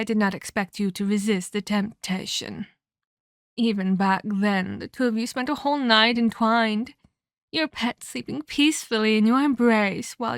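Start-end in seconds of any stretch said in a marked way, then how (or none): none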